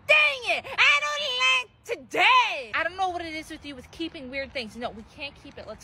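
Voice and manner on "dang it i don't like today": high, slow whining wail; whiny kid tantrum. Acted. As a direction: drag out the sulky complaint like a spoiled child. Kid can't keep the creature.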